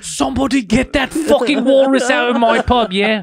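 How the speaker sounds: exaggerated British pub accent; mock English accent. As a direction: complain like an English publican, hammed up. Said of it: "when you go to England".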